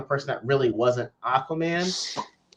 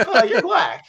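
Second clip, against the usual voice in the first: laughing mock surprise; 8 semitones higher, 5 dB louder, slower than usual. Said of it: "you're like".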